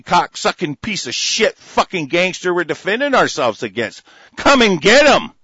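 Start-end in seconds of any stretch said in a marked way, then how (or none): 4.4-5.3 loud, high dare; defiant shout. Real, not acted. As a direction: throw the challenge at them. Defiant challenge to the government.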